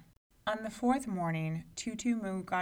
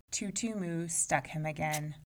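usual speaking voice

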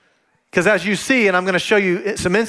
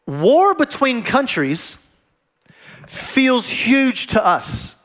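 slow, weighty preaching; 3 semitones higher, much slower than usual. Real, not acted. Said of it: "slow emphatic sermon point".

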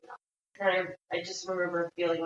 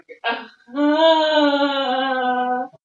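loud emotional laugh; 7 semitones higher, 12 dB louder than usual. Real, not acted. Overwhelmed emotional release.

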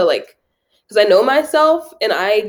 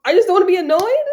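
high, rising incredulous question; pitched-up mock surprise; amused realization; 10 semitones higher than usual. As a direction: laugh at yourself as it dawns on you. Acts out amused realization about herself.

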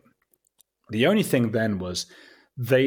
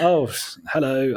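higher, exaggerated bashful English charm; Hugh Grant; 5 semitones higher, 5 dB louder than usual. Englishman greeting admiring Texans, overdone polite English charm. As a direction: overplay the bumbling English gentleman. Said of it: "I was sort of overly Hugh Granting it".

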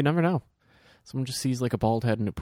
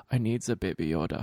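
Werner Herzog impression; 3 semitones lower, 3 dB quieter than usual. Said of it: "Werner Herzog going".

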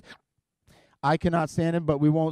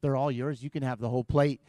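put-on eager boyfriend; 6 semitones lower, 7 dB quieter than usual. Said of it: "it's like".

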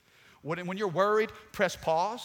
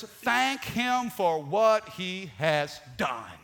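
slow scripture reading; 6 dB louder, much slower than usual. Shown as a demonstration. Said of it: "the Bible says".

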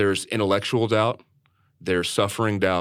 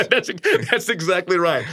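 laughing agreement; 11 semitones higher, 4 dB louder than usual. Real, not acted. Laughs at co-host's flat-earth joke.

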